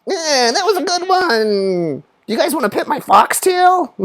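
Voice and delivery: very high, drawn-out put-on voice; mock convention geek, squeaky and stretched; anime-con nerd. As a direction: imitate an anime fan in falsetto. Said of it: "like, an anime con".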